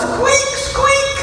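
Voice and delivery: tiny squeaky mouse cries; high mouse squeak. A narrator's reading. Baby mouse squeaking in the snow.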